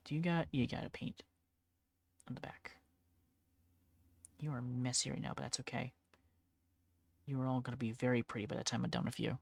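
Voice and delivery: softly chatting to the minis; quiet murmur. Real, not acted. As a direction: murmur to the models as you paint. Talking to the painted miniatures.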